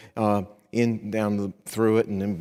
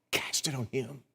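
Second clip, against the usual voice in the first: hushed, breathy answer after the shout; whispered answer to his own question; 2 semitones higher, 10 dB quieter than usual. Acted. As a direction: drop to a whisper to land it. Preacher drops to a whisper.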